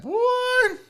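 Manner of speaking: high falsetto yelp; surprised exclamation. Real, not acted. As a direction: squeak it out in surprise. Seeing own fat head on camera.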